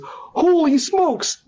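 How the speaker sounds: high, long-drawn cry of fright; scared exclamation. Acted. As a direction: stretch it out high in mock terror. Scared boy sees coffin coming.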